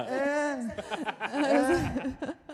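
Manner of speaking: real loud burst of laughter; giggling yes, yes through loud laughter; laughing. Real, not acted. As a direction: laughs hard, then gets words out through it. Laughing at co-host's quip.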